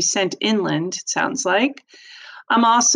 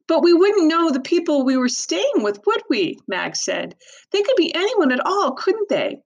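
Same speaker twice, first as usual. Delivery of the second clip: put-on high, worried young girl; anxious girl voice; 9 semitones higher than usual. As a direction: plead like a worried teen. Anxious sister questions parents.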